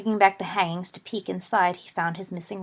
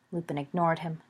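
usual speaking voice

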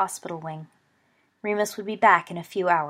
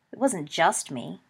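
ordinary delivery, nothing marked

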